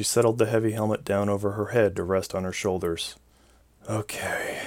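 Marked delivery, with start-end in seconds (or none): 3.9-4.6 soft unvoiced whisper. A narrator's reading. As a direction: breathe it out in a whisper. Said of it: "she whispered".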